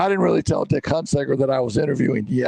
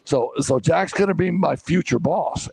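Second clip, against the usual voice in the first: usual speaking voice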